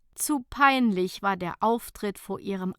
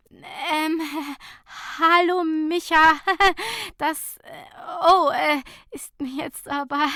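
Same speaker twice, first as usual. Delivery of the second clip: high, halting embarrassed stammer with nervous laughs; flustered stammer; 8 semitones higher, 6 dB louder than usual. A narrator's reading. Fabienne stammering to her colleague, high and flustered. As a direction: stumble over every word, caught naked. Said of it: "stammelte Fabienne".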